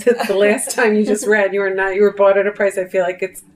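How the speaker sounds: ordinary delivery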